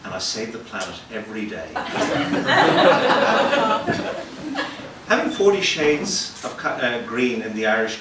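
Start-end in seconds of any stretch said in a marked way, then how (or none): 1.7-5.0 loud high-pitched laugh. Real, not acted. Comedian cracking up at his own boast. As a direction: burst out laughing.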